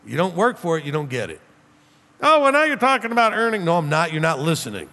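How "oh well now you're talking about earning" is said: mock objector. Imagined objector challenging the rabbi.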